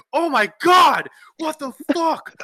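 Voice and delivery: high, loud retold horror; shocked outcry. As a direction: cry out in appalled disbelief. Retells own shock at the vomiting.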